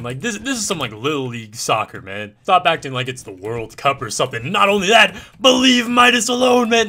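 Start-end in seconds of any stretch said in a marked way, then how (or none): 4.5-6.9 loud, high-pitched burst of protest; exaggerated outraged yell; indignant shout. Real, not acted. Mock outrage defending the bullied kid.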